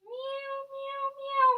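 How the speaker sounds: three very high mews; playful cat meow. Pretend. Teacher cueing one last cat pose, high meowing.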